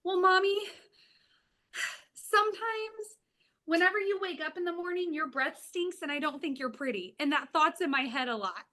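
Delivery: mom voicing her little girl's blunt confession; child's voice. Lightly voiced little girl confessing to mom. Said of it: "She says".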